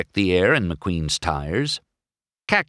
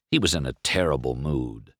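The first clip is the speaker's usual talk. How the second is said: ordinary delivery, nothing marked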